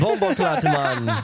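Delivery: high, loud mock-Jamaican exclamation; playful high-pitched Jamaican impression; Jamaican accent. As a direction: burst out in a put-on Jamaican accent.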